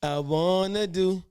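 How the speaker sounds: slow, swelling smooth-talker croon, loud at the end; Mister Smooth. Retold or imitated. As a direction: lay it on like a smooth-talking suitor. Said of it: "You had to be a Mister Smooth".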